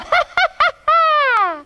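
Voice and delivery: loud, high villainous cackle; witch's cackle. Acted. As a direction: cackle in triumph. Witch laughs at her plan.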